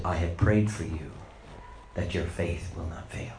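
low, soft promise; tender assurance. Retold or imitated. Jesus assuring Peter of his prayer, low and tender. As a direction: reassure him gently.

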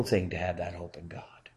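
whispered in awe. Real, not acted. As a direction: breathe it out as a hushed whisper. Closing reverent hush.

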